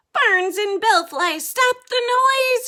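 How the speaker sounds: very high, loud screech; screeching bat. Acted. Bat overwhelmed by echoing singing, high loud screech. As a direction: scream it through the racket. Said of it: "he screeches".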